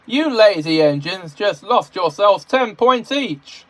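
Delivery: high, loud bossy shout; bossy yelling. A narrator's reading. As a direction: yell orders like a self-important boss. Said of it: "Percy came through the yard yelling".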